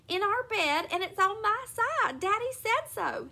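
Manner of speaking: high, insistent yelling; alarmed yelling. A narrator's reading. Mother insisting to daughters about the spider, high yell. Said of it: "pounding on the bed and yelling".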